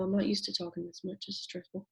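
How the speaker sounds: strained, fading voice; losing her voice. Real, not acted. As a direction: voice giving out. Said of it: "It's like I'm losing my voice".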